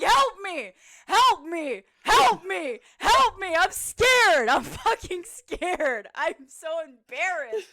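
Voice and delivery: high, very loud hammed-up pleading; panicked screaming. Hurt player begging teammate for help, panicked screaming. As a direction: scream for rescue in mock desperation, easing slightly at the end.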